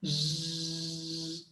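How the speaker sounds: ordinary delivery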